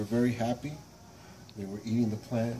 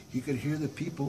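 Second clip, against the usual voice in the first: ordinary delivery, nothing marked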